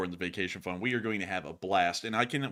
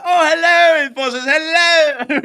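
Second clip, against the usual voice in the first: much louder, much higher, hammed-up hello; loud high greeting; 15 dB louder, slower than usual. Fan greeting the hosts with an over-the-top loud high hello. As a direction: belt out a goofy hello. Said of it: "just be like".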